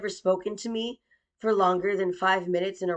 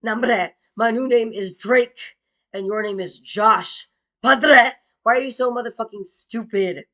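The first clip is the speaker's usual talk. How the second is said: loud taunt; 2 semitones higher, 7 dB louder than usual. Retold or imitated. Taunting father loudly.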